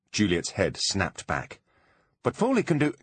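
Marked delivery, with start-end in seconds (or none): 2.2-2.9 pleading interruption; urgent protest. A narrator's reading. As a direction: cut off mid-protest, higher. Juliet cut off urgently.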